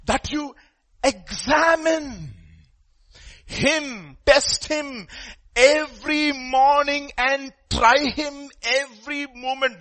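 high, loud, slow anguished plea; crying out. Acted. Job cries out to God in his trial, acted outcry. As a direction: cry it out, stretching the key words. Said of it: "he's, like, crying out".

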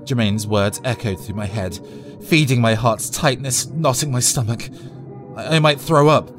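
ordinary talk

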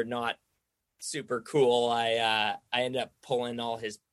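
usual speaking voice

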